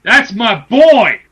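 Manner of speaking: slow, loud, high proclamation; emphatic shout. Real, not acted. Proclaiming loyalty to his favourite wrestler, emphatic shout. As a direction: belt it out, stretching each word. Repeats declaration far more forcefully.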